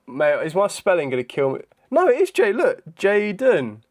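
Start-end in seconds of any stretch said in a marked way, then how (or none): 1.9-3.8 indignant protest. Real, not acted. Quiz answer contradicted, protesting.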